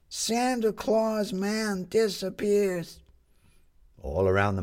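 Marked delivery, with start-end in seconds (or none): 0.1-3.0 high, slow headline cry; newsboy calling headlines. Acted.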